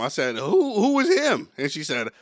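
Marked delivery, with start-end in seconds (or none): 0.3-1.5 high, loud confused who; startled question. Retold or imitated. To his girlfriend, asking who he is, baffled and high. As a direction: blurt it out, baffled and slow.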